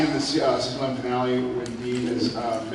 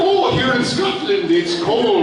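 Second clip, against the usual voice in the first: loud, higher mock Scottish; bad Scottish accent; 10 semitones higher, 5 dB louder, slower than usual. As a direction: mimic someone failing at a Scottish accent. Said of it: "says whatever the accent's supposed to be".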